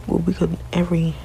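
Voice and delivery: ordinary talk, nothing marked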